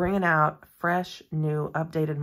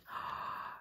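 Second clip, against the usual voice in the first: soft gasp; 14 dB quieter than usual. Real, not acted. Reveal of gold details.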